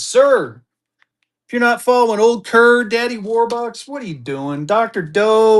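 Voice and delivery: ordinary talk, nothing marked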